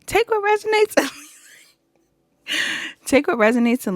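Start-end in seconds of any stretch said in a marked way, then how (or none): 0.0-1.2 high squeal of suppressed laughter; real giggles bursting through a high-pitched voice; cracking up. Real, not acted. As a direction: try to get the line out before laughter takes over. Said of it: "I'm cracking up".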